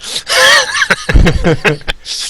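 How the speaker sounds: loud, very high burst of laughter; high laughing fit. Real, not acted. Gamer reacts to friend's hopeful remark, cracking up.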